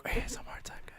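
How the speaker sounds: breathy ASMR whisper. Pretend. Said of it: "A S M R corner".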